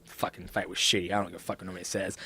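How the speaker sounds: low, soft, sulky grumble. Retold or imitated. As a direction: mutter it darkly to yourself. Retold sulking after fight.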